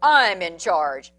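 loud, slow, low declaration; bully insists; bossy growl. Acted. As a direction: lay down the law. Big Bark asserts power.

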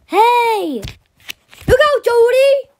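loud, high cry of warning; loud cry to Jody to look out; warning shout. Acted. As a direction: yell to save her from danger.

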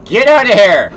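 loud high shooing yell; shouting. Real, not acted. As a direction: shout it away in alarm. Shooing away a cow.

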